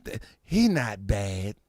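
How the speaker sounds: put-on low, slow soft old lady; a gentle grandmother excusing; grandma voice.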